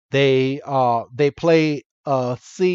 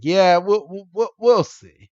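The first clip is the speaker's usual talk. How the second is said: higher, mocking put-down in a cocky voice; smug jerk; 4 semitones higher than usual. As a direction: imitate a dismissive jerk brushing someone off. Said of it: "just like a, a, a freaking asshole".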